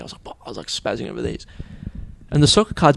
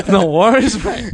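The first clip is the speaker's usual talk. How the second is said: mock reply; 5 semitones higher, 5 dB louder, slower than usual.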